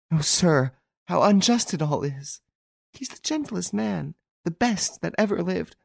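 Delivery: high, tearful desperate pleading; sobbing. A narrator's reading. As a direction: plead through tears for her lover. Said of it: "she sobbed out".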